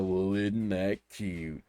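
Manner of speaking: slow, drawn-out cartoon catchphrase; imitated cartoon character. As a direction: imitate a cartoon guy cooing slowly. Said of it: "he would go".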